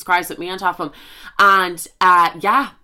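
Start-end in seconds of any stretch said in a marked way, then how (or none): none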